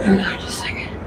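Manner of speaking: hushed, breathy aside; whispering. Real, not acted. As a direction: whisper it quickly under your breath. Whispered aside while walking.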